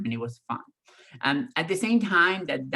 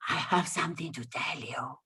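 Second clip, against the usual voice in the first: breathy hushed whisper from the back seat; retold whisper; 6 dB quieter than usual. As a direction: whisper it like a secret. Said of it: "Why are we whispering?".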